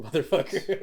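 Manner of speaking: ordinary talk, nothing marked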